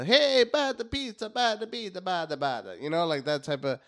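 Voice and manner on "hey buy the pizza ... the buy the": put-on loud, high caricature Italian accent. Random guy auditioning as the mouse, hammed-up Italian accent. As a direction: belt out a cartoon Italian patter. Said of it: "he does the".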